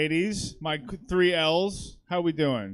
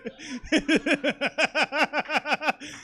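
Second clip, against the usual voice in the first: long high staccato giggle; real laughing fit; 8 semitones higher than usual. Real, not acted. Host cracking up over a great lead in, giggling. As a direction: giggle helplessly in a rapid run.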